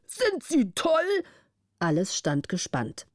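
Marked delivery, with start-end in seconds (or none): 0.1-1.2 hoch, langsam, entsetzt; mild gespieltes Geschrei des Vormunds. A narrator's reading. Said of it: "des Vormunds Geschrei". (entsetzter Ausruf, höher)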